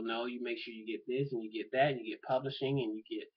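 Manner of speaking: usual speaking voice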